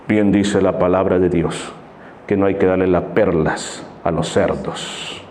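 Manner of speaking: deep, slow, grave preacher's voice; a low, weighty put-down from scripture; solemn retort. Acted. Other brother's solemn retort.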